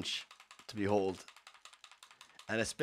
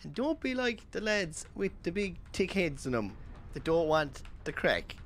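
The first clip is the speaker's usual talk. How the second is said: higher, slow comic Irish preaching; quoting the sketch's advice, higher and slow; Mossy Flood impression; 8 semitones higher than usual. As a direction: warn them like a pub sage, drawn out. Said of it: "Look it up, Mossy Flood".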